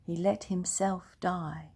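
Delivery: plain reading voice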